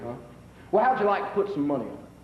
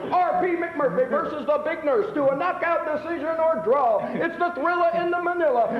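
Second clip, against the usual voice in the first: snake oil seller; 6 semitones higher than usual. Acted. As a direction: hawk it like a fairground huckster. Carnival barker taking bets.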